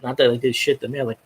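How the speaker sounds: ordinary talk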